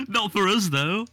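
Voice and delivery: laughing through the words; giggling while teasing. Real, not acted. Laughing at Ben's crisp munching.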